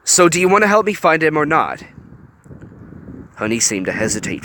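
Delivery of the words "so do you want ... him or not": pressing. A narrator's reading. Hiro pressing Honey.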